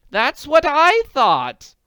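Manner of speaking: pouncing on the idea; eager. Acted. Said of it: "said eagerly".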